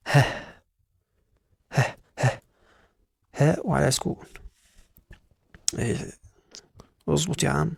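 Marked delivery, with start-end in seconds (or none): 0.1-2.4 ه as a short puff of breath; letter H sound. Shown as a demonstration. Teacher wants the sound instead of H's name, breathy puff. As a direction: exhale ه briefly, no letter name. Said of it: "وانا مش عايزك تقوله اتش".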